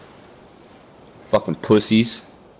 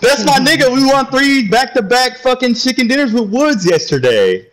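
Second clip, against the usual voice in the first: excited shout; 13 semitones higher than usual. Real, not acted. Hyped greeting continues.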